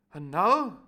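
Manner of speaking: loud plummy opener; posh doctor. Acted. Doctor Livesey adding a point, loud and plummy. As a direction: begin grandly. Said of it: "added the Doctor".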